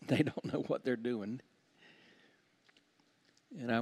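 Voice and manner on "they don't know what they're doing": softer, lower voice for Jesus's words; gently, as if forgiving; tender quote. Retold or imitated. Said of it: "and said".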